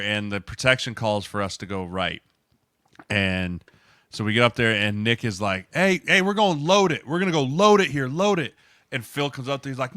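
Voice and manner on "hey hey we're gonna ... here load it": loud, much higher yell at the line. Retold or imitated. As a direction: shout the protection call at a teammate. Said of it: "they're just yelling at each other".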